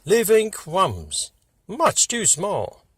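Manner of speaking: plain reading voice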